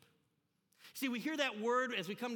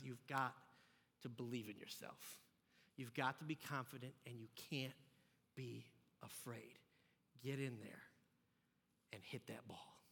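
hushed pep talk; 8 semitones lower, 10 dB quieter than usual. Retold or imitated. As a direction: coach him quietly and intently, dropping toward a whisper. Coach's pep talk in retold story.

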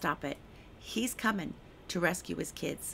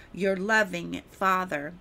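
loving stretched and stressed, louder and slow; emphasis on loving; 6 dB louder, slower than usual. Shown as a demonstration. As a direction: lean hard on loving. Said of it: "That was in bold".

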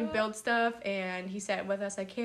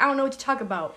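imitating a boy's country accent, a bit louder and higher; 4 semitones higher, 4 dB louder, much faster than usual. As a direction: put on a playful country twang. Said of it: "talk to each other in this country accent".

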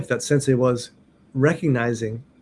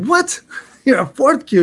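high, squawked mock disbelief; incredulous teacher; 11 semitones higher, 3 dB louder than usual. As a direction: imitate a teacher who can't believe it. Retells teacher's disbelief at rank.

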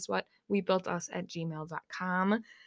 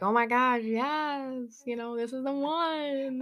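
gushing excitement; 5 semitones higher, slower than usual. Retold or imitated. Gushing over new love.

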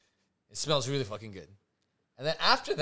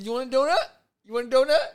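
high, loud, sing-song question; mock offering; 9 semitones higher, 8 dB louder, faster than usual. Boyfriend replaying his offers, high sing-song. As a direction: playfully pester her. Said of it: "I said".

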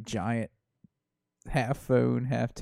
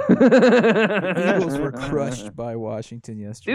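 laughing; 6 dB louder, slower than usual. Real, not acted. Laughing reading of the result.

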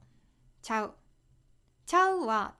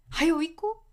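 urging someone to hurry, higher and louder; impatient hurry-up; 6 semitones higher, 4 dB louder than usual. Shown as a demonstration. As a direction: nag them to come quickly. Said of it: "怖く聞こえるかな？".

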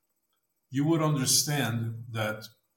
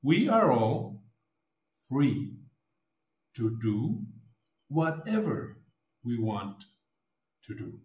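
spaced out, long pause between each phrase; slow with long pauses. Shown as a demonstration. Dad stressing the last phrase for his daughter, slow and paused. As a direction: let every phrase land alone, slowly. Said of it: "Listen, it's important".

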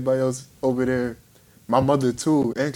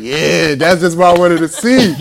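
loud cheer; 8 dB louder than usual. Retold or imitated. Cousin cheering on push-ups.

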